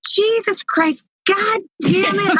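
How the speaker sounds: put-on high, drawn-out little-kid cursing; four-year-old swearing. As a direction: stretch each curse in a child's voice. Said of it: "a little four-year-old".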